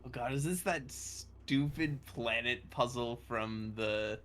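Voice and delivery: normal talking voice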